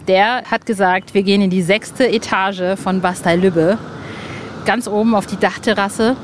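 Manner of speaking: normal talking voice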